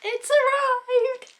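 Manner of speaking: high slow shriek of joy; shrieking jackpot winner. Real, not acted. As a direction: shriek it like you just won. Parcel arrives, shrieked.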